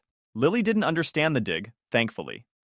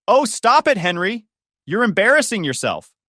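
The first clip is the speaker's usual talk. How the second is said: much higher and louder, scolding across the room; calling out angrily; 10 semitones higher, 6 dB louder than usual. A narrator's reading. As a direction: yell after him to stop. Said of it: "Mom called out".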